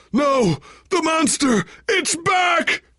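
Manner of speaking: high, loud panicked shout; terrified scream. Acted. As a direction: scream in terror at the monster. Monster attack, screaming.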